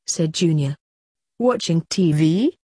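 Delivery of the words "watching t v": mildly skeptical rising question; lightly doubtful, lifting at the end. A narrator's reading. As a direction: ask it with a touch of disbelief. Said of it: "Blake said skeptically".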